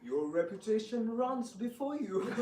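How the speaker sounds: ordinary delivery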